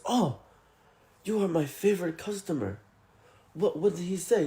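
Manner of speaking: high-pitched imitation of a dismayed owner; concerned surprise. Shop owner to his favorite customer, dismayed. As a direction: act taken aback on a customer's behalf.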